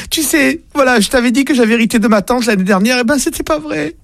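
high pleading wife's voice, frantic; panicked wife. Acted. Wife caught in bed begging husband not to shoot, high and frantic. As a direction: beg him not to shoot in a high squeal.

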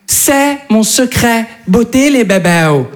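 high, slow, cooing sales pitch; influencer voice. Retold or imitated. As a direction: coo like a beauty influencer. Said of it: "elles disent".

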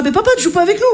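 put-on high, whiny child's complaint. Children complaining to their father, in a high whiny child voice. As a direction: pout like a small kid.

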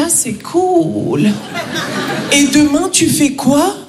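slow, blasé mock voice; unimpressed friend. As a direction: play it totally unimpressed. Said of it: "Il me dit". (le pote français blasé, lentement)

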